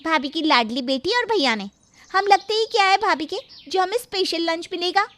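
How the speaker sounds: sarcastic sulk. Acted. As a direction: sneer it with hurt pride.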